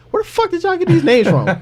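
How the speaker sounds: high, laughing incredulous outburst; can't believe these names; laughing disbelief. Real, not acted.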